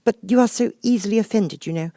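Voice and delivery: Alice pleading with the offended Mouse. Acted. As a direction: beg forgiveness earnestly. Alice voiced.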